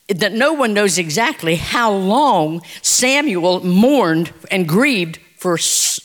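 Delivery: ordinary talk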